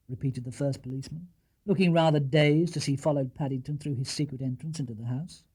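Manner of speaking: usual speaking voice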